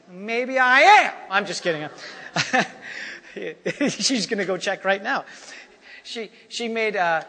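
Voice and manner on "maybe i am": loud, drawn-out playful retort; mock-defiant tease; teasing comeback. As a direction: stretch it out, rising high and loud. Teasing comeback to his wife.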